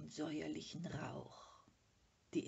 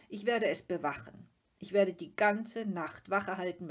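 bad-tempered resolve; 9 dB louder than usual. A narrator's reading. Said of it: "kratzte schlecht gelaunt im Moos herum".

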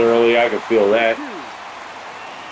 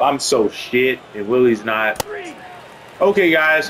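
normal talking voice

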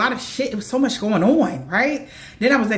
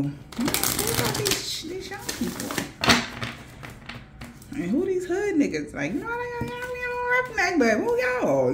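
high, soft, stretched-out puzzlement; baffled question; 11 semitones higher, 6 dB quieter than usual. Retold or imitated. Seeing strange young people in the dream, high and baffled. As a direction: ask it high and bewildered.

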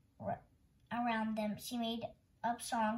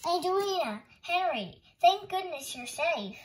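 higher, louder calls with long-drawn words; relieved calling out; 6 semitones higher, 6 dB louder than usual. A narrator's reading. Uncle Louie finding the lost children safe, calling out.

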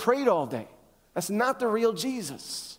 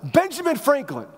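higher-pitched, hammed-up exclamation; mock curse yell; 6 semitones higher, 3 dB louder than usual. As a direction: yell the name like a swear word. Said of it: "made a mistake and yelled out".

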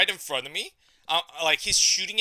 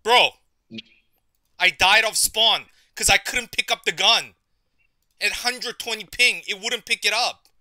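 loud, higher-pitched complaint; angry rant; 5 semitones higher, 11 dB louder than usual. Real, not acted. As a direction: vent it loudly at the game. Raging about lag.

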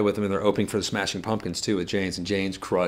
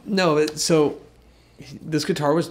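ordinary talk, nothing marked

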